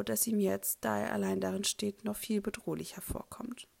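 plain reading voice

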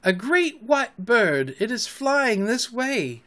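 slow, high marveling voice; drawn-out, high-pitched awe; awed wonder. A narrator's reading. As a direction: describe it slowly in wonder. Nibs reporting the marvel in wonder.

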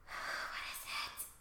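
sighing, whispered and slow; a sigh then a slow whispered question; weary whisper. Acted. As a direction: breathe it out tired and hushed. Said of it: "And he's like".

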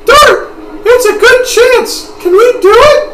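put-on very high, loud falsetto girl voice; excited girl character. Girl urging Dart to take the chance, high excited falsetto. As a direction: pipe up high and eager, way above your own voice.

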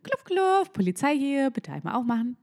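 zaghaft höfliche Polizistenstimme, anfangs hoch; freundliche Polizei. Acted. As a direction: eine viel zu nette Polizei parodieren. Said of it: "Die macht nicht so". (übertrieben höfliche Polizei)

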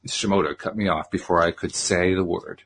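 usual speaking voice

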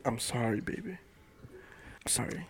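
very soft, fading to a near-whisper; soft mock apology. Man apologising to his baby for being selfish, hushed and acted. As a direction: act out a tender, hushed sorry. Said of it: "I'll be like".

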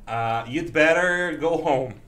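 slow, careful English example; slow teaching demo. Shown as a demonstration. Teacher demonstrating had better for the class, slow. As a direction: spell out the phrase slowly for students. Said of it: "a contração do had better".

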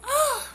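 high laughing cry; a sudden high laugh and gasp. Real, not acted. Laughing at how late it is, high cry of laughter.

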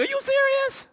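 high, squeaky mock disbelief; squeaky God voice. God mocking the excuse-making believer, in a high squeak. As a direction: play God as comically incredulous. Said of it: "God like".

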